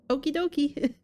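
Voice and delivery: high, playful read-out; comic high-voiced sign-off; chirpy note reply. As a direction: pitch it up like a silly cheerful reply. Reads handwritten reply on note.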